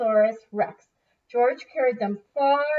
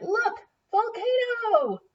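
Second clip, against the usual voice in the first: high, slow, drawn-out alarm; startled exclamation; 12 semitones higher than usual. Acted. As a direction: point at the volcano in shock. Granny spots the volcano.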